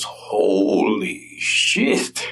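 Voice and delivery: very slow drawn-out holy shit; stunned foreman. Acted. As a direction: let it fall out slow, in shock. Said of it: "the foreman goes".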